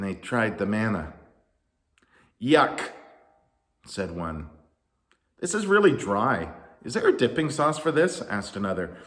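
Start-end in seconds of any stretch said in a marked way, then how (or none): 2.4-2.9 loud yuck of distaste; disgusted. A narrator's reading. Complainers taste the manna. 5.4-8.2 higher, louder picky grumble; complaining. A narrator's reading. Picky crowd complains about food.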